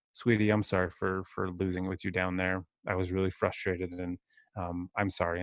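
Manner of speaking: quiet, sincere apology to a daughter; tender apology. Retold or imitated. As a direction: soothe her, say sorry softly.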